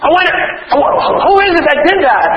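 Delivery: high, eager delight; pleased surprise; excited. Retold or imitated. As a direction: sound thrilled by the discovery. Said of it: "I was like".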